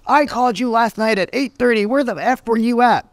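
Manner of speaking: high, mock-furious client demanding answers; imitating angry client. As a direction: play the entitled client chewing him out. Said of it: "a client that calls and says".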